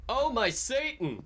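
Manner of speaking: mock scream. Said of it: "we screamed".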